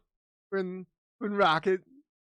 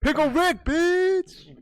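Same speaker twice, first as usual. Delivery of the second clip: high, louder cartoon yell; Pickle Rick shout; 10 semitones higher, 5 dB louder, much slower than usual. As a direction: belt it like the cartoon catchphrase. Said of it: "Rick and Morty".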